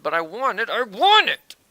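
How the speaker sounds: mock tantrum, building to a high shout; greedy insisting. Loot grabber demanding an item he won't need, greedy insisting.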